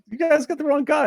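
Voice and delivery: very high, unruffled Transatlantic impression; Cary Grant, unbothered. Mistaken man telling kidnappers of their error, unruffled Transatlantic accent. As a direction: protest without being put out, in a hammy Atlantic accent. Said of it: "he's not put out, but he's sort of like".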